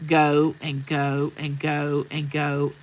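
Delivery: slow, evenly paced go after go; slow repetition. Real, not acted. Speaker describing her long-building temper, slow repetition. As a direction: drag out each repeated go.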